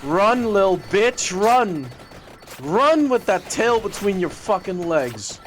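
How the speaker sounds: loud high jeer; taunting shout. Real, not acted. Streamer yelling at a troll to run, loud jeer. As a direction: chase him off, jeering.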